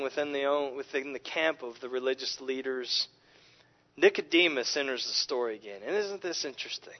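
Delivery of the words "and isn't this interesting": whispered aside; intrigued whisper. Real, not acted. Preacher teasing his congregation with a twist, conspiratorial whisper. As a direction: lean in and whisper it like a secret.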